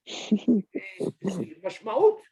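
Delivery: ordinary delivery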